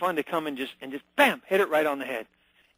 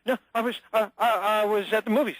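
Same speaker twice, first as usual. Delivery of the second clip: nervous higher voice; 5 semitones higher than usual. Shown as a demonstration. Suspect answering the investigator nervously, voice raised higher. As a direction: voice pitched up, nervous and stumbling. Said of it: "Your voice goes up".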